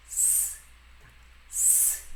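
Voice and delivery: long voiceless s hiss; quiet s demo. Shown as a demonstration. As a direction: hiss a soft s. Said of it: "a gente vai pronunciar".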